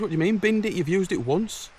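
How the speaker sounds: disbelief. Retold or imitated. Outraged that bag was binned.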